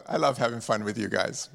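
ordinary talk

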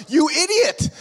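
hammed-up exasperated parent; Pakistani voice scolding. Preacher voicing the parent in his head calling him an idiot, mock Pakistani accent. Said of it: "this Pakistani voice in my head".

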